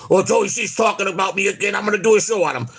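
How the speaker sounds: high whiny mock of the rival. Mimicking Quad planning a show, high mocking whine. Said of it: "you're gonna go".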